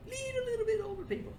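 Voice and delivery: put-on very high, light squeak on little bit older; squeaky high voice. Talking to himself, savoring it in a squeaky high voice. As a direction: toy with a tiny cartoon voice. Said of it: "I like the way that sounds".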